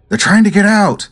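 high, urgent villager's call; mock alarmed call, pitched high; villagers calling out. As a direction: call out a warning to the others. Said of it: "you hear them call".

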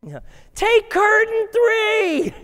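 high, loud, drawn-out game show cry; game show excitement. Acted. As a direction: call it like a contestant going all in. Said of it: "then you're like".

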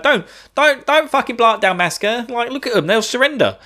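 high mock pleading; Larsa. Younger brother begging the villain to spare a city, high mock plea. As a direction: protest in a high, put-on voice. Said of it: "he's like".

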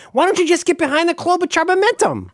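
high, goofy sports-fan voice; a mock fan pitched high. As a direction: ham up a fan's demand. Mocking sports-fan quote.

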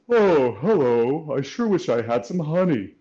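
a sleepy bear longing for honey; brown bear voice. Shown as a demonstration. Coach showing children the brown bear voice. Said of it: "we call this the brown bear voice".

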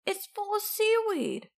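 high-pitched cry of dismay; high storybook outburst; mild shout. A narrator's reading. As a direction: exclaim it high as if horrified. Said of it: "shouted Len".

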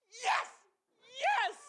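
high, loud mock kid cheer; imitating excited child yelling. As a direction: cheer like a thrilled little boy. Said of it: "He's yelling".

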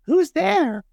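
very high, tiny squeaking mouse voice; squeaky mouse. A narrator's reading. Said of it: "Mouse cries".

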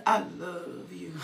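a lover's deep I love you; deep romantic voice. Shown as a demonstration. Preacher mimicking a lover's deep voice, low and laughing. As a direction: drop way low and croon it, half laughing. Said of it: "You know when you get that deep voice?".

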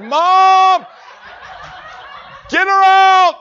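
high, loud, urgent; desperate plea. Retold or imitated. Patient pleading loudly with mom. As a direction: beg mom to get grandma out.

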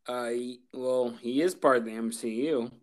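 normal talking voice

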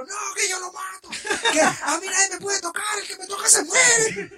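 imitating a drunk friend's high shouted threats; high mock tough-guy yell; mock angry yell. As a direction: yell it like a brawler.

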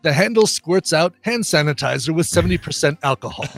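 John Cleese as Q. Acted. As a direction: primly lecture the careless agent. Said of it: "I'm doing the John Cleese".

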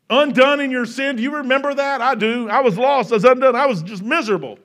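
pressing the congregation hard from the pulpit; full-force preaching. Acted.

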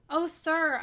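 loud, urgent cry; Oh sir cried out loud. A narrator's reading. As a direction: call out to the master in alarm. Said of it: "I cried".

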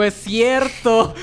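high, slow, delighted exclamation; drawn out with a grin; excited. Real, not acted. Excited at news, laughing.

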